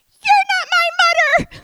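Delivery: put-on very high, very loud toddler yell; little sister yelling at her brother, shrill and full-voiced; shouting. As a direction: scream it as a furious little girl. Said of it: "And all I hear is".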